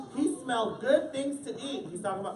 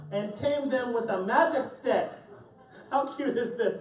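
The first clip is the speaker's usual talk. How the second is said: plain reading voice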